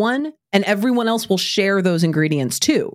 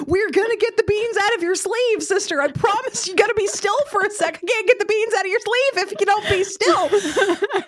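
put-on high, sing-song reassurance to a panicked child; mock soothing mom voice; 12 semitones higher than usual. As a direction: coax a jittery little girl to hold still, half laughing. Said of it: "I'm like".

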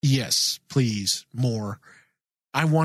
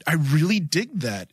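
normal talking voice

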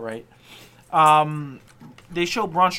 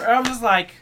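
normal talking voice

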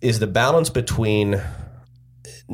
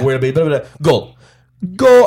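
loud, high goal call; goal call shout; 5 semitones higher, 7 dB louder than usual. Retold or imitated. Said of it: "he'll do that".

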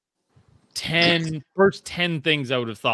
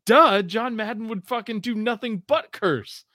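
mock duh; 6 semitones higher, 3 dB louder than usual. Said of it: "to be like".

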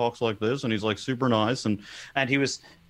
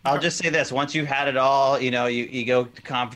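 ordinary delivery

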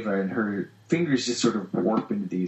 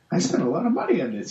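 voicing a character; 7 semitones higher, faster than usual. Cliff voiced, high and quick.

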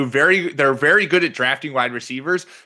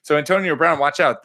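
ordinary delivery

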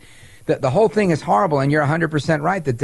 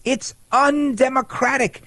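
a light mock whine, higher and slow; a hint of a whiny congresswoman, higher and slow; 9 semitones higher, slower than usual. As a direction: sneer it out in a mock complaint. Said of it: "she said".

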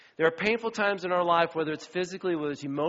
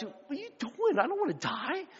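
panicky disciples; 11 semitones higher than usual. Retold or imitated.

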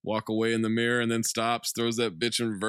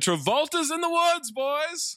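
excited; slower than usual. Acted. Hyping the reveal.

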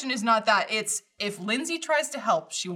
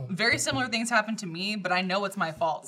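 ordinary talk, nothing marked

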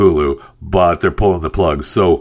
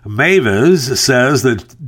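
ordinary talk

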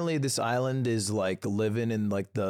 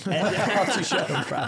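laughing through the words, higher and louder; laughing repeat; 6 semitones higher, 4 dB louder than usual. Real, not acted. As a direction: echo it while cracking up.

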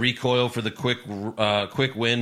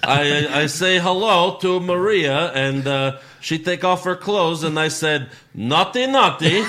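put-on loud, high Bulgarian accent; hammy Borat-style boasting; 4 semitones higher, 5 dB louder than usual. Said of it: "he's Borat".

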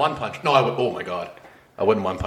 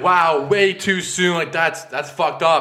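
higher, slow drawn-out disapproval; offended rejection; 7 semitones higher, slower than usual. Retold or imitated. Imitating a girl rejecting a high five joke, offended rejection. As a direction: stretch it out high, as if appalled. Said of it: "She's like".

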